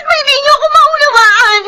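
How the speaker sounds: mataas at malakas na hinaing na may iyak; umiiyak na pagsabog. Acted. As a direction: ibuhos ang sama ng loob habang umiiyak. Daughter's tearful outburst at father. (umiiyak na pagsabog ng damdamin sa ama)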